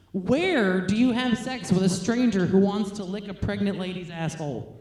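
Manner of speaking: ordinary talk, nothing marked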